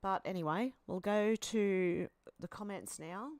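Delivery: ordinary talk, nothing marked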